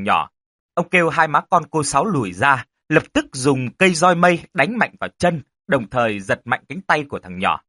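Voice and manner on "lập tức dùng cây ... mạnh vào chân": ordinary delivery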